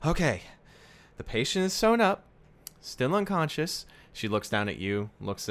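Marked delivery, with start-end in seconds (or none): 0.0-3.9 higher, brisk nurse giving a report. Acted. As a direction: report like a tired nurse, pitched up. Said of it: "She's like".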